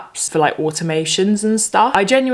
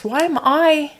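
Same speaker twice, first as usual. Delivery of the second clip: exasperated; 8 semitones higher than usual. Real, not acted. Complaining to viewers about the ban, exasperated and laughing. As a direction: throw up your hands, why me. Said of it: "so frustrating".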